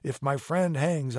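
plain reading voice

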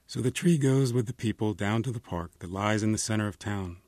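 ordinary delivery, nothing marked

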